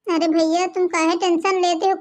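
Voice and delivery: normal talking voice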